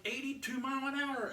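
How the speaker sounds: ordinary delivery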